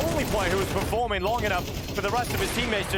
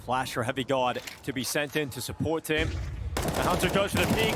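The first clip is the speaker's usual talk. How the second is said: ordinary talk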